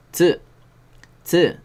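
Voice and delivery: "tsu" with a clear ts at the start; t then s in "tsu"; ts sound. Shown as a demonstration. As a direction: click the t into "tsu". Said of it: "¿Cómo pronunciamos tsu?".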